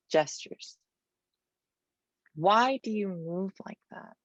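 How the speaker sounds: measured, dramatic poetry recital; poem reading. Acted. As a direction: read each line as a charged question and answer.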